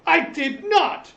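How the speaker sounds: high loud protest with a laugh in it; a guest caught out, loudly protesting his innocence; indignant denial. Acted. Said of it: "the speaker looks at him and goes".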